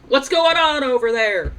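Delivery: loud, slow call from a distance; loud slow shout toward a commotion. Acted. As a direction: call out across the park. Character calls out across the park.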